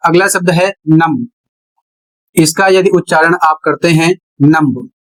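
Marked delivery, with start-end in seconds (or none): 4.4-4.9 नम्ब with a clear final b; wrong pronunciation. Shown as a demonstration. Teacher shows students the mistaken numb. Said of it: "तो गलत है".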